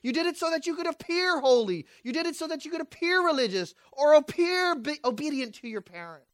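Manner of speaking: loud, high sermon push; preaching at full force. Acted. Preacher pressing the church on false motives, loud. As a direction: drive it home to the congregation.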